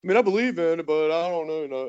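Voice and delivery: mock noncommittal hedging; hesitant closet conservative. People hiding that they're conservative, imitated hedging. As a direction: imitate someone afraid to commit, waffling. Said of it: "act like they're afraid to say".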